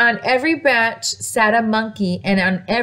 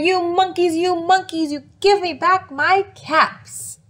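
upset scolding; 7 semitones higher than usual. Acted. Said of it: "Because you're very upset".

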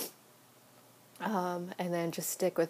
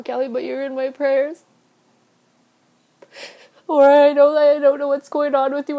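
blubbering; 8 semitones higher, 8 dB louder than usual. Real, not acted. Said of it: "and I'm crying".

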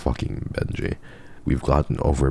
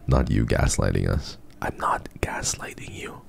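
hushed banter; 4 semitones lower, 4 dB quieter than usual. Real, not acted. Mock argument with chat.

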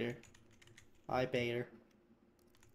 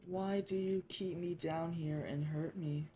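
slow, higher-pitched victim voice; Billy; 5 semitones higher, slower than usual. Acted. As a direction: stretch out the plaintive question. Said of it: "I'm gonna do a voice for Billy".

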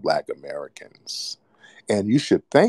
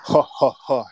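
higher, deliberate ha-ha-ha laugh; mocking laugh; 5 semitones higher, 3 dB louder than usual. Real, not acted. Debater ridiculing a rival's claimed identity, mocking laugh. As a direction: laugh scornfully at your opponent. Mocking opponent in heated argument.

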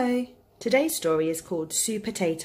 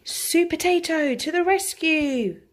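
high, drawn-out superhero fanfare; heroic announcement; 10 semitones higher, slower than usual. A narrator's reading. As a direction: herald the hero slowly and high.

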